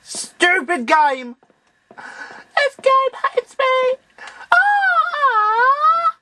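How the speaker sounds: high, slow drawn-out wail at the game; real rage at a failed move; frustrated yell. Real, not acted. Raging at the game.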